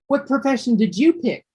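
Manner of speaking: lilting question, YOU picked out high; asked as a question. Shown as a demonstration. Teacher showing how Lucas asks the question, questioning tune with stress on YOU. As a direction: read it as someone asking, lifting "you". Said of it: "somebody's asking this".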